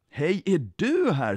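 high, slow, swooping greeting; glad surprise. A narrator's reading. Jesus träffar den ledsne, glad surprise. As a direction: greet with happy astonishment.

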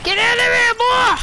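high loud victory yell; raging gamer. Real, not acted. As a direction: yell it at the beaten enemy. Gamer yelling after a kill.

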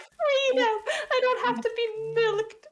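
high, loud cry of a freed goat; liberated goat. Acted. Player voicing the runaway goat, mock-triumphant cry. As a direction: proclaim it as the triumphant escaped goat. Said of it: "she was like".

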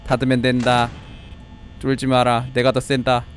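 low, monotone machine bravado; robot voice. Pretend. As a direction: state it all like an unfeeling android. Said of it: "난 쫀드로이드".

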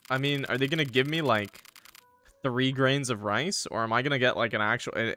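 ordinary talk

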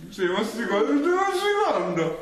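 laughing. Real, not acted. Laughing at partner chewing.

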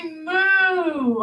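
drawn-out playful moo; cow moo. Pretend. Teacher showing kids the cow sound, playful moo. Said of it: "cows say".

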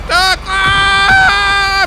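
high, loud yelp into a long scream; panicked scream. Real, not acted. Gamer dodging an incoming rocket, panicked scream. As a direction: shout the warning, then scream.

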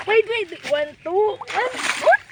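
high, strained panicked counting; hanging on for dear life. Real, not acted. Counting while hanging on, slipping. (nanginginig na pagbilang habang kumakapit)

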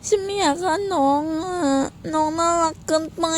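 a touch of whiny pretend crying over ไม่อยากฆ่าน้องอะ; half-hearted sob, pouting; mock whimper. Streamer pretending to cry over killing the cute character.

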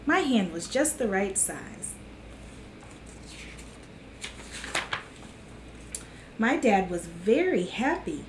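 storybook voice for little listeners; gentle children's book reading. A narrator's reading. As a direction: read it like storytime to kids. Reads picture book to kids.

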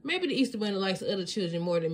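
plain reading voice

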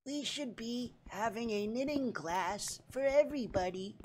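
put-on soft, high old-lady voice; halting little grandma, slow and quiet; grandma in Congress. Grandma slipping bigotry into her proposals, soft high put-on voice.

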